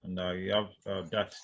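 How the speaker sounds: normal talking voice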